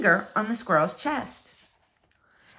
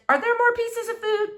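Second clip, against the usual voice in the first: much higher, squeaky little voice; the squirrel wondering about food, squeaky and much higher; squeaky squirrel thought; 12 semitones higher than usual. Acted. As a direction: pipe it up like a tiny hungry squirrel. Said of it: "squirrel thought".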